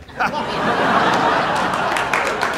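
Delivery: long unvoiced wheezing laughter; long breathy laugh. Kuma begs for one laugh, then wheezes with laughter. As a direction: let it all out in a wheeze. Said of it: "いっぺんだけ笑わせてください".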